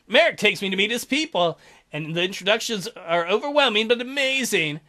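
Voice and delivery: ordinary delivery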